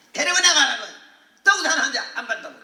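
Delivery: loud, sharp retold command to throw her out; doctor's angry order. Doctor ordering the husband to take her out, loud and angry. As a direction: bark it like an irritated doctor.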